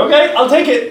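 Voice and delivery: high eager acceptance; acted reply. Student accepting the teacher's deal, high-pitched reply.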